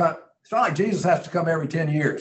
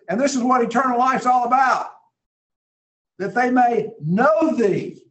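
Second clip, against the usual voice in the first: fervent exclamation; 6 semitones higher than usual. Real, not acted. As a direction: proclaim it with building fervour. Climax of quoted prayer.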